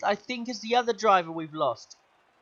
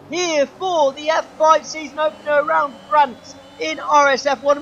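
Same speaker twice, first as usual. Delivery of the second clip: excited; 6 semitones higher, 7 dB louder than usual. Real, not acted. Race opener called right after start.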